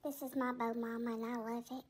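low, soft worried pet voice; timid cat Tigger. Acted. Tigger defends his bow.

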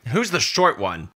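loud, high, pointed question; brash owl familiar. A narrator's reading. Owl Pip looking up at Jory, brash. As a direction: pipe up loudly as a cheeky little creature.

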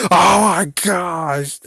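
high, loud, slow laugh-soaked gasp. Real, not acted. Vlogger cracking up at his own Chucky joke, laughing loudly. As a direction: let the words dissolve into laughter.

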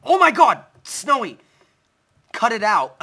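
very high, loud shriek; startled scream. Real, not acted. Gamer startled by his wolf, shrieking scream. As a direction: shriek like you just got jumped.

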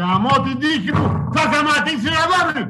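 real exasperated cursing, pitched high; angry outburst. Real, not acted. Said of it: "Ηρέμησε".

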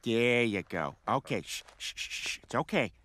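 soft shushing, nearly a whisper; calm him like a frightened child, very softly; hushing. Acted. Soothing hyperventilating Cleveland.

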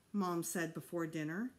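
plain reading voice

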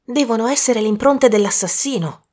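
normal talking voice

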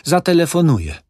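high, loud firm decision; decided old woman. Acted. Old widow deciding to phone, high and firm. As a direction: settle it firmly.

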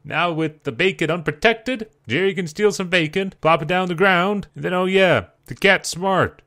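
normal talking voice

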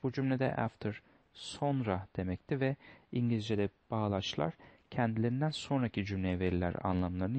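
ordinary talk

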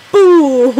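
sudden loud, high mock scare; playful boo. Vlogger mock-scaring her viewers at the end, loud playful boo. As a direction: jump out at the viewers with it.

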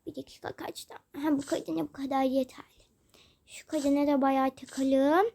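whispered scheming; sneaky whisper. Acted. Child whispers while sneakily pranking the women. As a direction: hush it, plotting.

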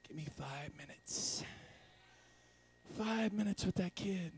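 soft, seething aside; mock-menacing quiet wish; inner thought. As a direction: think it aloud under your breath. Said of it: "I was just sitting there thinking".

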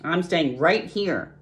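slow, stubborn rat grumble; grumbling rat. A narrator's reading. Rat refuses to go to the fair, grumbling. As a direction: dig in grumpily, drawing it out. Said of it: "grumbled the rat".

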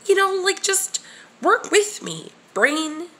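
high pleading plea to her own brain. Real, not acted. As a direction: beg your brain to cooperate.

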